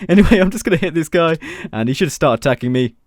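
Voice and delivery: ordinary talk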